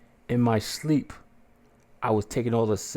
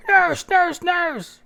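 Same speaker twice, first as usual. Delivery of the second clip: much higher, louder calls for the nurse; calling out; 7 dB louder, much slower than usual. Retold or imitated. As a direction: yell for help down the hall.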